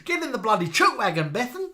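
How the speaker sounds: loud, high mock Aussie rant; Australian accent. Teasing Bethan to go to school, mock Australian accent. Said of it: "We've been doing Australian accents to you all evening".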